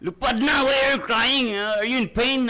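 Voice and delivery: high, loud coaxing questions; voiced as God, high and loud, urging. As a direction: plead like God urging a hurting child. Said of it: "Biyong ng Ginoo".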